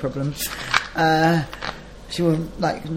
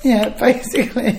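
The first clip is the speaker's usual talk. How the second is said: normal talking voice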